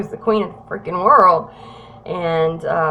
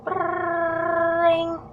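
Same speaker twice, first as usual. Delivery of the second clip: high, soft trilled ring; mimicked ringing phone; imitated phone ring; 9 semitones higher, 3 dB quieter than usual. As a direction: hold one high note like a ringtone. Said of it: "my coat rings".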